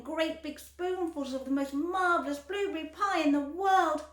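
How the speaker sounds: loud gushing delight; rapturous. A narrator's reading. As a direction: rave about the taste. Violet raving about pie.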